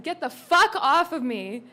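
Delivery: put-on loud, slow shout at a drunk guest; angry shout. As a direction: push him off with your voice, furious and loud. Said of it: "I said".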